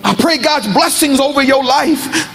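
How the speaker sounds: high, drawn-out preacher's exhortation; fervent preaching. Real, not acted. Preacher leading fathers to bless their sons, fervent preaching. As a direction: charge the congregation with slow, fervent blessing.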